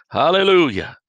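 loud, high burst of praise; ringing hallelujah; joyful shout. Real, not acted. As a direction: shout it out to heaven. Preacher exulting mid-sermon.

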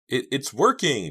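high, loud, halting; excited stammer. A narrator's reading. Girl feeling rope give way, stammering. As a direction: trip over the first word excitedly. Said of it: "Jade stammered".

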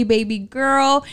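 usual speaking voice

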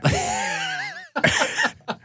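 high cackling cartoon laugh; Woody Woodpecker laugh. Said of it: "try to do a Woody Woodpecker impression".

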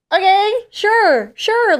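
high, loud, slow "okay, sure, sure"; dazed high-pitched yes; stunned agreement. Retold or imitated. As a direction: draw out the words in shocked disbelief. Stunned reply to money offer.

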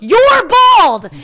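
high, loud mock yell; imitating an exasperated shout; Elaine. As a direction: hurl it as a furious accusation, high and loud. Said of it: "She goes".

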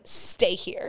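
bossy order. Retold or imitated. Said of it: "she would tell someone".

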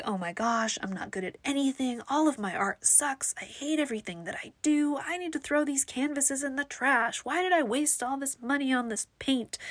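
high-pitched self-critical spiral; mock meltdown, pitch climbing as it goes; going off the deep end. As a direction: spiral into exaggerated despair about yourself. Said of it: "off the deep end".

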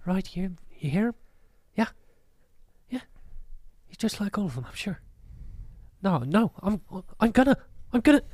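lightly voiced muttering troublemaker, working himself up; overheard agitated townsperson. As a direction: start half-whispered, grow insistent.

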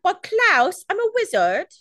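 high-pitched mock British boy; squeaky-high fake British accent; Harry Potter British. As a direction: do a bad British child-wizard voice. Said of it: "It's like I'm watching a Harry Potter movie".

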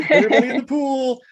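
high, loud mock hail; hammed-up summons; calling out. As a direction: holler it to the crowd, laughing. Joking call to crowd.

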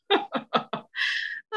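short airy chuckle; a quick, voiceless little laugh. Acted. Shirley teases about the partner at funeral, short chuckle.

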